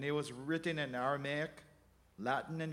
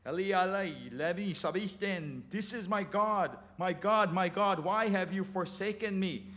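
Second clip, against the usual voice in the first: loud cry; 4 semitones higher, 4 dB louder than usual. Acted. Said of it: "cried out with a loud voice".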